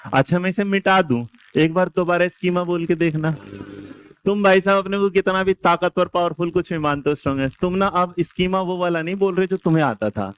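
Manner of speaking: normal talking voice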